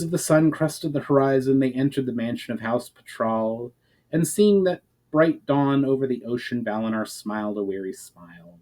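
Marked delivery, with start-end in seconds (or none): none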